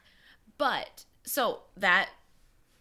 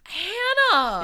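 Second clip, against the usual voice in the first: loud, high delighted cry; excited shout; 10 semitones higher, 9 dB louder than usual. Real, not acted. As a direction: call her name in delight. Amazed reaction.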